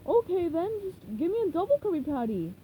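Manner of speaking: a hint of Patrick; lightly voiced starfish.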